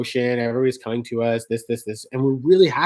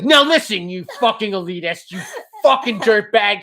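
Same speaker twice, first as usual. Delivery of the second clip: mock angry rant; 7 semitones higher than usual. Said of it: "She's like".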